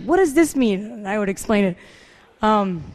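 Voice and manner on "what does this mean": high, loud mimicked question; puzzled friend, imitated. Friend needing things explained, high mock-puzzled question. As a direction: ask like a baffled newcomer, played for laughs.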